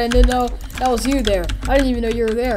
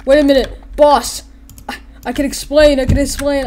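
nervous pleading; 5 semitones higher, 5 dB louder than usual. Retold or imitated. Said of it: "He was like".

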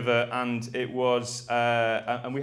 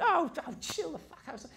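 Danny imitated; 12 semitones higher, 6 dB quieter than usual. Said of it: "And he went".

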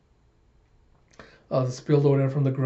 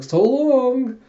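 high, loud, sung-out goodbye; casual farewell; 11 semitones higher, 6 dB louder than usual. Retold or imitated. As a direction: toss off a breezy, stretched farewell. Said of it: "with a casual backhanded wave".

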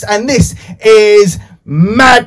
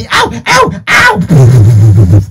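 mock pain yelp; 10 semitones lower, 6 dB louder, much slower than usual. Host yelping in mock pain at the puppet's bite. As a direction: yelp as if the turtle bit your nose. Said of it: "He just bit my nose".

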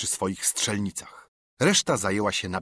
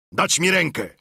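higher, louder demand for help; shout; 5 semitones higher, 4 dB louder than usual. A narrator's reading. Silver on the ground demanding a hand, shouting. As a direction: bark it out from the sand. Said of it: "krzyknął".

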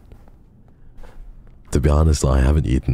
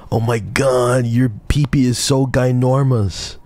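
high, hammed-up gushing voice mocking chat; 9 semitones higher than usual. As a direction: gush it sarcastically, pitched high. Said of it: "Try fucking saying that and see if somebody doesn't laugh".

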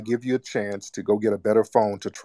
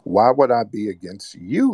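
usual speaking voice

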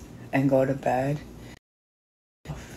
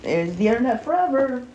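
higher retelling of a stern warning; retold scolding; 8 semitones higher than usual. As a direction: quote yourself lecturing her, pitched up. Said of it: "I told her today, I said".

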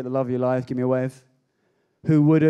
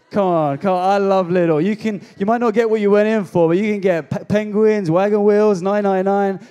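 higher, louder happy rave; excited gushing; 4 semitones higher, 6 dB louder than usual. Real, not acted. Preacher raving to the crowd about Lidl bargains, excited and loud. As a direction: gush like it's a treasure hunt.

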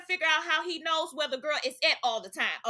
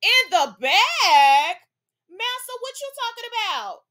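loud, drawn-out laughing disbelief; incredulous outburst; 6 semitones higher, 6 dB louder, slower than usual. Real, not acted. Host reacting to Jaden's answer, laughing loud disbelief. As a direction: stretch it out, laughing in shock.

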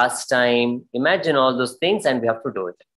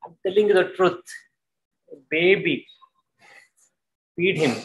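pleading sorrow; 4 semitones higher than usual. Shown as a demonstration. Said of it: "It's a Karuna Rasa".

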